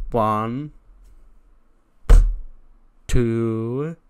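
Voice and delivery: drawn-out count, punched loud; referee's count. Acted. [name] counts the pin as referee, drawn out. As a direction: call each number like a referee.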